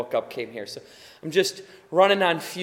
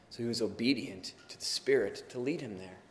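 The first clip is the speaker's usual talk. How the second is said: ordinary delivery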